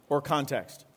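ordinary delivery

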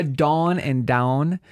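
"dawn" and "down" stretched very slowly, the vowels pulled apart; overdone vowel demo. Shown as a demonstration. Host overdoing "dawn" and "down" to show the vowels. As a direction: exaggerate "dawn" against "down". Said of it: "I'm overdoing it".

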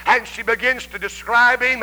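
ordinary delivery

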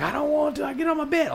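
very high mock-kid whine; imitating whining child. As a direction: protest like a whiny little boy. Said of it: "He's like".

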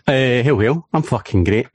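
ordinary delivery

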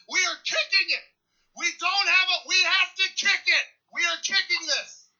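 high, loud sideline yell at teammates; retold shouted call. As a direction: bark the decision at the huddle. Retold yelling the call on field.

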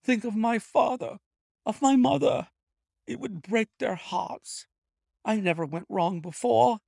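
high, loud frightened begging; panicked clerk begging for mercy; desperate thief pleading. A narrator's reading. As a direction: plead with the detective not to prosecute. Ryder's pleading voice held.

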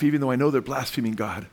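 usual speaking voice

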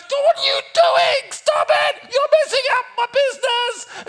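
high, loud screeched protest; hammed-up furious stallholder shriek; outraged lady. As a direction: scream at him to stop wrecking your stall. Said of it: "and the lady goes".

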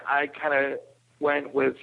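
ordinary delivery

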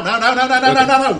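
high, laughing mock panic; half-laughing, frantic stream of refusals. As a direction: play up a rising panic at the oncoming zombie. Player reacts to charging zombie.

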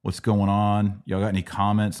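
ordinary talk, nothing marked

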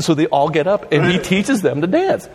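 ordinary talk

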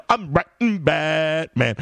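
mock awestruck delight; giddy glee. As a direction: gloat like a kid with a new toy. Acting his own glee.

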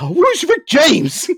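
high, drawn-out mock question; imagined puzzled listener. Co-host imitates a listener pausing the episode, mock puzzled. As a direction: draw it out high, laughing through it. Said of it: "Now they're gonna pause. It's like".

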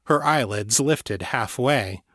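usual speaking voice

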